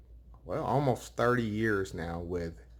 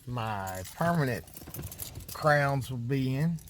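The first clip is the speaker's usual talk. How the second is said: talking through numb lips; 3 semitones higher than usual. Real, not acted. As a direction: speak with a numbed mouth after dental work. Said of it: "my lips are still super numb".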